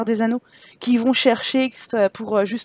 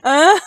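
loud cackling witch laugh; wicked cackle; 4 semitones higher, 7 dB louder than usual. Pretend. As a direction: burst into a long, gleeful cackle. Cackles over abandoned children. (ricanement sonore de sorcière)

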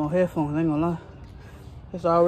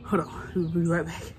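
ordinary talk

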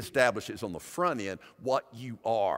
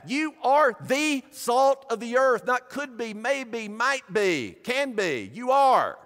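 higher, louder, slow preaching with you ARE hammered; preaching at full force; 10 semitones higher, 6 dB louder, slower than usual. Acted. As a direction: proclaim it over the congregation. Preaching climax on identity.

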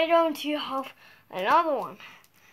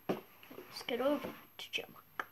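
much softer secret whisper; 3 semitones lower, 17 dB quieter than usual. Pretend. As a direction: whisper the plan. Pretend sneaking.

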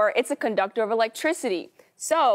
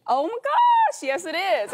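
excited; 8 semitones higher than usual. Real, not acted. Guest guesses right answer.

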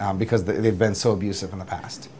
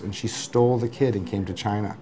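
usual speaking voice

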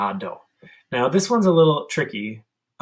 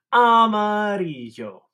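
armadillo voice; 8 semitones higher than usual. Shown as a demonstration. Teacher voicing a hand armadillo. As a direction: let the hand armadillo squeak it. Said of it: "armadillo and say".

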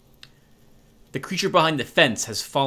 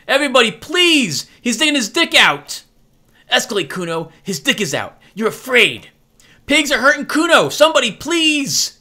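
high, loud fake-panic yelling; hysterical screaming; 11 semitones higher, 7 dB louder than usual. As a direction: scream so the neighbours hear. Kuno screams accusing the cop.

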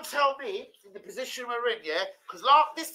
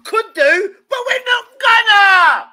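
mock defiant shout; 11 semitones higher, 11 dB louder, slower than usual. Mocking manager's choice, shouting.

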